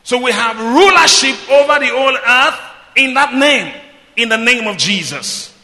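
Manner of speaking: loud, high proclamation; full-force preaching. Real, not acted. Preacher declaring the believers' rulership in Jesus' name, full-force preaching.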